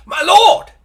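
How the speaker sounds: very high, loud hail; sergeant's urgent cry. A narrator's reading. Sergeant greeting a cloaked lord with relief, urgent cry. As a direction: shout it out as a sergeant. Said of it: "says the sergeant".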